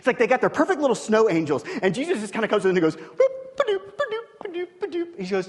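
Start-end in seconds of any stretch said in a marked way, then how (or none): 3.0-5.2 playful kicking noises. Retold or imitated. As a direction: bounce through the boops like stomping snow. Jesus kicking snow angels apart.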